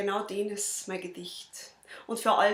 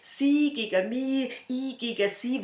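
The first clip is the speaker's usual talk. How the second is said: louder, higher, drawn-out repeat; heated standoff; 4 semitones higher, 6 dB louder, slower than usual. Acted. Poet recites the two sides facing off, heated. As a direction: build the chant, pushing each side harder.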